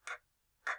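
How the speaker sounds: "k, k" as bare clicks; isolated phonics "k, k"; beginning sound. Shown as a demonstration. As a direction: repeat just the sound "k, k", no vowel. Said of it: "What's the beginning sound".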